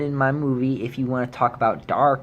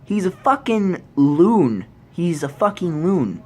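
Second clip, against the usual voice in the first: exasperated; 5 semitones higher, 3 dB louder than usual. Real, not acted. Angry verdict on the director.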